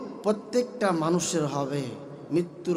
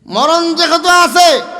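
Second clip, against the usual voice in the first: higher, louder, slow fiery sermon; preaching at full force; 12 semitones higher, 14 dB louder, slower than usual. Acted. Preacher rousing the congregation, loud and slow. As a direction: hammer it home to the crowd.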